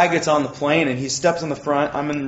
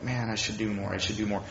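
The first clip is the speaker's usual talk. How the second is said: guilty mutter; 6 semitones lower, 10 dB quieter than usual. Retold or imitated. Condemned inner self-talk.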